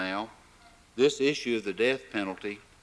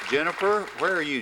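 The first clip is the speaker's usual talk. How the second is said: raised, high call across the hall; loud call to find a student; calling out a name; 7 semitones higher, 4 dB louder, faster than usual. Real, not acted. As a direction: call her name out to the crowd.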